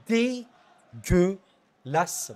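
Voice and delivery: "dégueulasse" stretched out very slowly in disgust; mot étiré. Real, not acted. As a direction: drag the word out to show disgust. Slamming a defender's display. ("dégueulasse" traîné très lentement, dégoûté)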